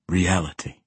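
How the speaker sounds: cold, final one-word answer; cold finality. A narrator's reading. Assassin answering his companion, cold finality. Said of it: "with cold finality".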